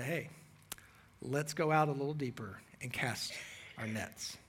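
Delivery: soft hushed whisper; whispered invitation. Retold or imitated.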